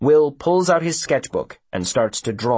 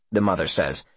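usual speaking voice

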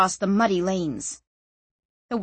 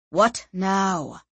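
weary sigh. A narrator's reading. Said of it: "sighed Gregor".

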